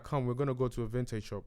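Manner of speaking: low, soft retelling of the director's words; imitating Mac quietly. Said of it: "And then he was like".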